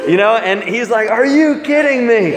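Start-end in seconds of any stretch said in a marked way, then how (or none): 1.1-2.3 high-pitched mock outrage; bugging out. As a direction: imitate a friend freaking out on the phone, pitched way up. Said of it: "he's bugging out on the phone".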